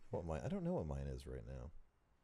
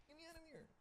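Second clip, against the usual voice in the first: tiny high trapped voice; Small Man in a Box; 18 dB quieter, much faster than usual. Acted. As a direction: squeak it out like a tiny boxed man. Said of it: "Small Man in a Box".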